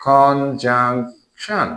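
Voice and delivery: Conjunction drawn out very slowly, syllable by syllable; slow teacherly breakdown of conjunction into syllables. Shown as a demonstration. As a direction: stretch each syllable of conjunction. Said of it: "syllable করে".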